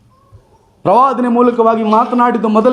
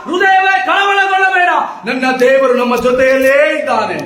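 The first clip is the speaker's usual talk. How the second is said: raised, high-pitched preacher's cry; fiery preaching; 4 semitones higher than usual. Acted. Preacher rallying the congregation against fear, fiery preaching. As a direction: preach it out full force to the hall.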